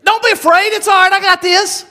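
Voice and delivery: high-pitched, acted voice of the Lord; lifted, insistent divine reassurance, acted out; reassuring Lord. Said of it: "and the Lord's saying".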